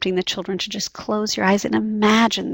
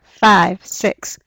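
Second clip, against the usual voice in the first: counting on aloud; 6 dB louder than usual. Shown as a demonstration. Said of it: "So if our start number is four".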